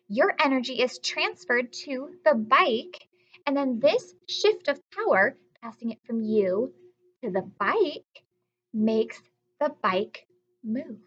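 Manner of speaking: ordinary talk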